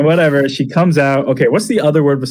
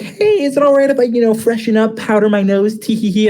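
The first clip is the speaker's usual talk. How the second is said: put-on high, fast, giggly girl voice; mock coy girlfriend; girly voice; 8 semitones higher than usual. Asking to freshen up, high giggly girl voice. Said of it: "but she's like".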